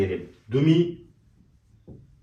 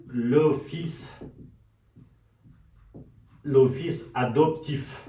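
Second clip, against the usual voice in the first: slow demo; slower than usual. Shown as a demonstration. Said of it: "سعی کنین".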